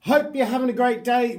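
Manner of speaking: loud, high, sing-song channel greeting; big booming sing-song intro to the viewers; hyped YouTube intro. Acted. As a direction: belt out the welcome like a show host.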